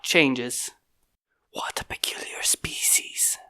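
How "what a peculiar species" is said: very soft unvoiced whisper; whispered private remark. A narrator's reading. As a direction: breathe the words, wondering. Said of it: "he whispered to himself".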